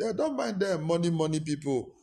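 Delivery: high scornful brush-off, then money money; a lightly voiced scornful church member; mock dismissive grumble. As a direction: sneer like a bitter member.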